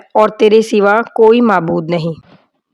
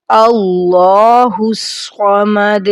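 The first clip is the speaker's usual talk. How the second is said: slow, drawn-out Arabic recitation; much slower than usual. Shown as a demonstration. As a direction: recite each word slowly and clearly. Teacher recites prayer slowly.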